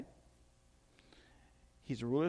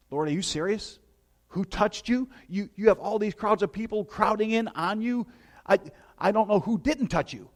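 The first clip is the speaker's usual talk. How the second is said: incredulous disciples; 7 semitones higher, 7 dB louder than usual. Acted. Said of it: "people are saying".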